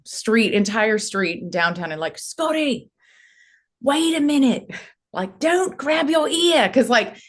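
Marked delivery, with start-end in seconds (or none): 2.2-7.2 high, slow Australian accent impression; Philip Noyce Australian accent. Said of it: "my Australian accent is not great".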